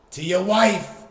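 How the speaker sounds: a loud, high, triumphant comic yell; shouted punchline. Real, not acted. Joking about sending pics to the listener's wife, shouted. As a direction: slam the punchline home at full voice. Punchline to the dick pic joke.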